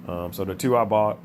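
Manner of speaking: normal talking voice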